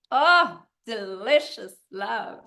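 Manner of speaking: loud, rising oh, then slow relish; swooning, emphatic pleasure; savouring delight. Real, not acted. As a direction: relish each word slowly. Savouring memory of being in love.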